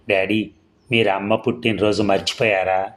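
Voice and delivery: ordinary delivery, nothing marked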